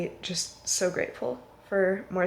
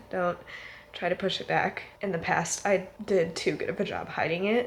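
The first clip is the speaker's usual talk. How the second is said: normal talking voice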